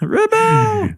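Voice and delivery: very high drawn-out yell; hammed-up rebel shout; mock battle cry. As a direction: shriek it like a rally call. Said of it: "I don't know why I said it like that".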